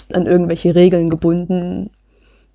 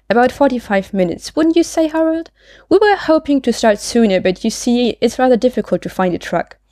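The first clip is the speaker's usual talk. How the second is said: higher, chatty character voice; Maud, chatty and unbothered; 5 semitones higher than usual. Acted. Maud chatting to policeman about driving, unbothered. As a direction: start high and prattle on, settling gradually. Reads Maud's part in dialogue.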